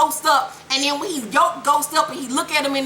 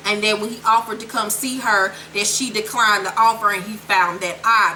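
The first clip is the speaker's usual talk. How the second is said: usual speaking voice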